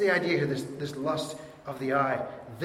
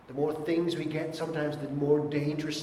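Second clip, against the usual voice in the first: ordinary talk, nothing marked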